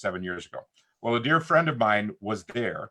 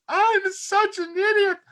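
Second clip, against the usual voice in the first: high-pitched, louder mock cry of self-blame; self-berating outburst; 5 dB louder than usual. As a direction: act out beating yourself up. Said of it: "My response was at first".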